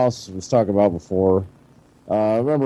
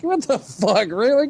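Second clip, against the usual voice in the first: pitched-up mock incredulity; retold disbelief; 11 semitones higher than usual. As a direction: react like baffled family, voice raised high. Family reacts in disbelief, retold.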